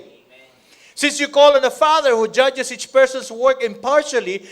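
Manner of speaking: loud, high, slowed preaching; preaching at full force. Acted. Preacher proclaiming Peter's verse to the church. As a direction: thunder the verse out.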